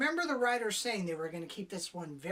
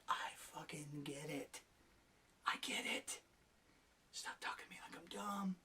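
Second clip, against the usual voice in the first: hushed, much softer, fed-up whispering; exasperated whisper; 3 semitones lower, 11 dB quieter than usual. Acted. Acts his exasperated reaction to writers.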